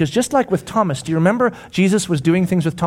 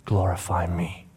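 low, slow and soft; hushed promise; 9 semitones lower, 7 dB quieter than usual. Acted. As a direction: let the promise sink to a murmur. Jesus reassures Peter.

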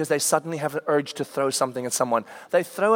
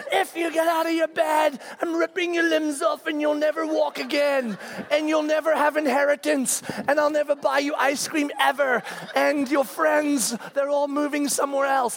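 much higher mock-furious threats; empty threats; 9 semitones higher than usual. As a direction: rattle off over-the-top threats. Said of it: "the threatening thing".

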